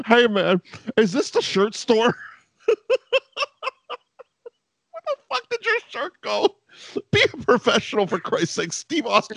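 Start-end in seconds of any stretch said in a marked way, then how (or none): 0.1-2.1 put-on dopey drunk. Mocking shirtless Shawn. 2.7-6.6 mock baffled. Mocking question to Shawn.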